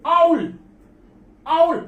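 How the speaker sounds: आउल said loud and high, the vowel opening sharply; emphatic demo. Shown as a demonstration. Teacher correcting students, आउल said loud and high. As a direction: ring out आउल twice. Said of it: "ये लिखा आउल".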